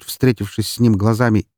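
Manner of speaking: plain reading voice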